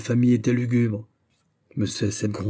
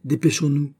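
raised, higher voice, mildly urgent; captain calling out; 5 semitones higher than usual. A narrator's reading. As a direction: hurry them along like a captain. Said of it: "Le capitaine criait".

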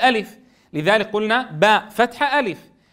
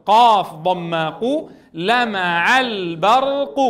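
slow, chant-like spelling, building the word piece by piece; much slower than usual. Shown as a demonstration. Teacher building the word syllable by syllable. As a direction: stretch each syllable as you build the word. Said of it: "تعالوا لنهجئها سوية".

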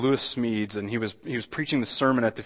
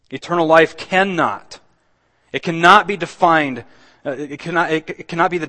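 loud, raised insistence; emphatic preaching; 4 semitones higher, 7 dB louder than usual. Real, not acted. Preacher insisting on what eternal life cannot be, emphatic preaching. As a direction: press the point hard on the congregation.

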